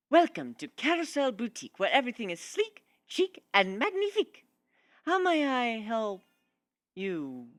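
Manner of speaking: a refined unicorn welcoming a customer into her boutique; Rarity voice. Acted. Shop owner greeting a newcomer, high and grand.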